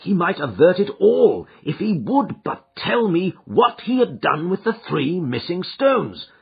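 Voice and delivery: ordinary delivery, nothing marked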